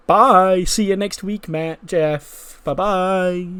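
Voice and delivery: high, loud sing-song farewell; creepy goodbye. Acted. Bray saying goodbye to Matt and Jeff, high and sing-song. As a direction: wave them off in a sweet, eerie lilt.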